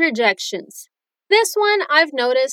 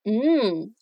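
soft, pleased mmm at the pizza; low hum of enjoyment; savoring hum; 4 semitones lower, 3 dB quieter than usual. Shown as a demonstration. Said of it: "Muita gente diz".

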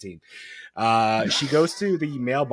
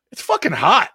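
higher, sweet little halfling rogue voice; sweet little girl voice; 7 semitones higher, 5 dB louder than usual. Retold or imitated. Father retelling his daughter's sudden curse, sweet little voice. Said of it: "In her sweet little halfling rogue voice".

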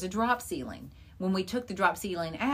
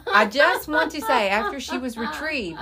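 ordinary talk, nothing marked

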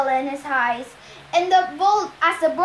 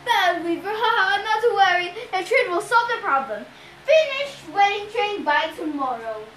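raised, jolly monster voice; grinning Cyclops; 5 semitones higher, 4 dB louder than usual. A narrator's reading. Cyclops orders his bride to finish weaving, grinning. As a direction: scold lightly through a grin. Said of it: "Polyphemus grinned".

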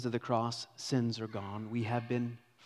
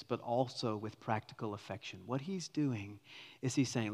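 usual speaking voice

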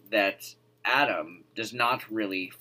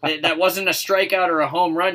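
mock excited; 8 semitones higher, 8 dB louder than usual. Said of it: "I was like".

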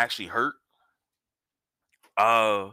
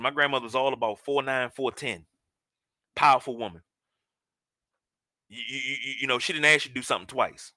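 usual speaking voice

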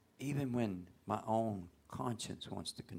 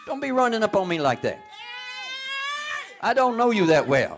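full-force preaching; 16 dB louder than usual. Acted.

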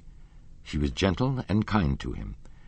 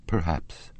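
loving despair. A narrator's reading. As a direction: let love and despair weigh on the word. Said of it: "with so much love and despair in his voice".

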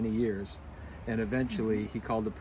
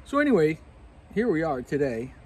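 higher, louder host restart; 5 semitones higher, 7 dB louder than usual. Real, not acted. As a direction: bring the show back on track. Loud restart of the show.

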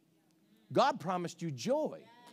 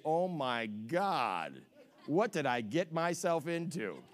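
slow, low dismayed groan; mock dismay; 7 semitones lower, 3 dB quieter, slower than usual. Spouse regretting the marriage, slow low dismay. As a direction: drag it out in horror.

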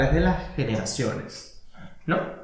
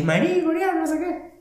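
high excited quote; 13 semitones higher than usual. Retold or imitated. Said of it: "y dije".

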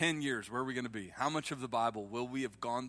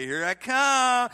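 much higher, louder, slow and looming; playful Holy Spirit; 10 semitones higher, 12 dB louder, slower than usual. Pretend. Holy Spirit teasing a reluctant churchgoer, hammed-up. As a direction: play it like a parent approaching with medicine. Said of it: "the Holy Spirit's gonna be like".

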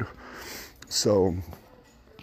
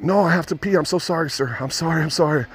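pleading; 10 semitones higher than usual. Retold or imitated. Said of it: "And I'm like".

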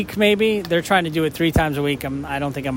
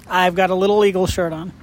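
ordinary delivery

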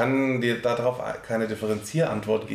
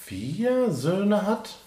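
high, very slow reveal; dramatic pause; 8 semitones higher, much slower than usual. Real, not acted. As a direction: drag it out for effect.

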